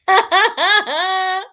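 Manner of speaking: loud, high laughter; real burst of giggling cackle; amused laugh. Real, not acted. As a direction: laugh heartily at yourself. Laughing at her swearing accent.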